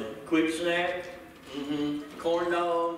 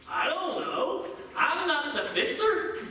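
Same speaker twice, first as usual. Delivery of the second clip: higher, laughing, mock exasperated; tossing the problem back, amused; exasperated reply; 7 semitones higher, slower than usual. Spouse brushing off the caller: higher, laughing.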